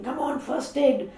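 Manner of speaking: put-on urgent child calling for help; mock yell. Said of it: "started yelling".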